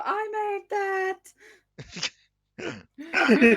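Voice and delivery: high little goblin voice. Pretend. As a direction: squeak it out proudly like a tiny creature. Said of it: "a little voice go".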